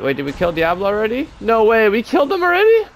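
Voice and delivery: high, loud disbelief; shocked it's already over; surprised. Real, not acted. Surprised boss already dead.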